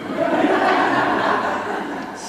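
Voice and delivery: light breathy laugh, high; a delighted little breath of a laugh; airy laugh. Real, not acted. Laughs after the quote.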